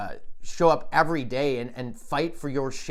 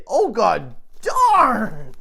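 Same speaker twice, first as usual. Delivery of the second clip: loud, very slow shocked cry; 6 semitones higher, 9 dB louder, much slower than usual. Retold or imitated. Man shocked by the lady's answer, loud slow cry. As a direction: gasp it out, stunned. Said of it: "You know, shocked".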